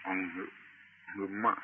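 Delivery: soft, separated sounds "อัง", "หึ", "มะ"; sounded-out syllables. Shown as a demonstration. Teacher breaking the chant OM into its sounds, softly sounded out. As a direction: sound out "อังหึ", "หึมะ" piece by piece, softly. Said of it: "จากการเปล่งเสียง".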